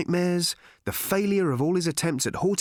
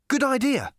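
high, chirpy reply; breezy interjection; 12 semitones higher, 3 dB louder than usual. A narrator's reading. Ford butts in on Arthur's announcement, high and breezy. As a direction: chime in from nowhere, pitched high.

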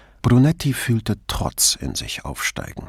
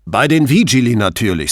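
irritated; 2 semitones higher, 6 dB louder than usual. A narrator's reading. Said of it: "sagte Pater etwas ungehalten".

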